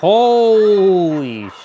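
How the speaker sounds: drawn-out loud exclamation; shocked shout. Real, not acted. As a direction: belt it out in disbelief.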